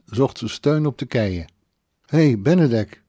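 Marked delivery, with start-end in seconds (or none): 2.1-3.0 raised, louder acted call. A narrator's reading. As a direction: call his name to get his attention. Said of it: "riep ze".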